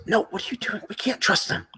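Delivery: a scared man hissing a warning; panicked second voice. Acted. Second survivor warning his friend in a panicked whisper. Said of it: "you hear a second voice".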